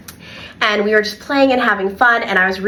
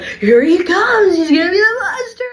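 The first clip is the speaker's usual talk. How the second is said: playful monster announcer; 10 semitones higher than usual. Retold or imitated. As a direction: stretch it out like teasing kids about a monster. Kids' monster game, announcing.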